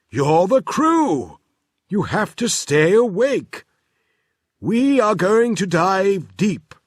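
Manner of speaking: put-on busy inventor brushing the children off; Professor Tangle voice. Professor orders the crew.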